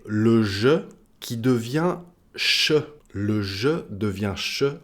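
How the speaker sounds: slow "je", then a hissed "che"; je becomes che. Shown as a demonstration. Teacher showing a sound change, "je" turning into "che". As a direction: contrast voiced "je" with voiceless "che". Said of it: "les transformations phonétiques".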